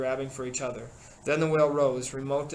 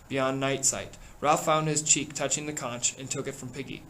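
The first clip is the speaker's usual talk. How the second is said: usual speaking voice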